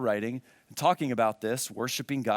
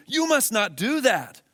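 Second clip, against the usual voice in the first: angel's command; 11 semitones higher, 7 dB louder than usual. Acted. Angel forbids John's worship in reading.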